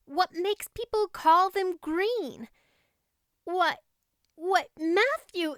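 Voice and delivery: put-on high, breathless girl bubbling over with Christmas joy; a delighted child who can hardly contain herself; excited young girl. Anne's joyful Christmas lines.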